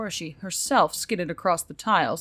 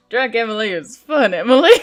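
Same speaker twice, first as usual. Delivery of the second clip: amused aside; 3 semitones higher, 11 dB louder than usual. Real, not acted. Narrator aside after laughing.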